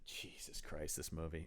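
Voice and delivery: breathy exasperated whisper; whispered aside. Real, not acted. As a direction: sigh it out under your breath. Exasperated aside about the film.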